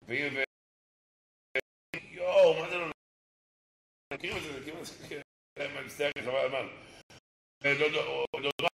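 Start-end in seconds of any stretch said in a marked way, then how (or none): none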